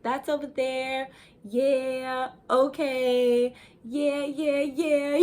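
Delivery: mock a woman gesturing wildly, voguing as she talks; over-the-top voguing talk. Mocking loud hand-waving talkers, hammed-up sass. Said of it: "like you're voguing".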